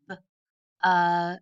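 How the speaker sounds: B, uh given as separate sounds; sounds tapped out. Shown as a demonstration. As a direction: tap out each sound. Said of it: "Let's tap out the sounds".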